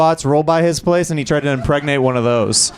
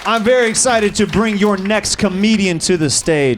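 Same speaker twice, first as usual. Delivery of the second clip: comedian introduction; 4 semitones lower than usual. Acted. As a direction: bring the next act on warmly. Host introducing next comedian.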